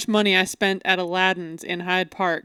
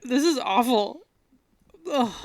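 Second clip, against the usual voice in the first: after bitter medicine; 4 dB quieter than usual. Real, not acted. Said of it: "she hates it".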